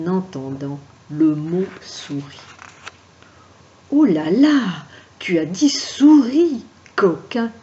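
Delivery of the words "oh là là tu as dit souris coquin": high, loud cry of alarm; frightened outburst. Acted. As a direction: squeal it out in fright. Frightened marquis scolds valet.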